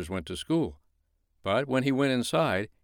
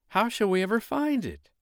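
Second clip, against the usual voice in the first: put-on worried little boy; 13 semitones higher than usual. Boy worried about lost eye.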